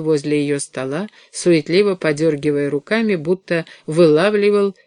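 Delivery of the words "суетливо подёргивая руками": ordinary delivery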